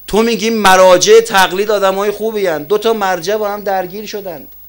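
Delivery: loud, high-pitched challenge from the pulpit; forceful preaching. Real, not acted. Preacher challenging listeners about clerics in conflict, forceful and loud. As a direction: ring it out to the congregation.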